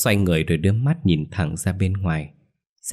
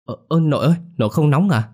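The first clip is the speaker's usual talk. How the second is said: put-on much higher, louder frightened girl; alarmed child; 8 semitones higher, 4 dB louder, faster than usual. A narrator's reading. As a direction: cry out in alarm. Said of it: "hốt hoảng".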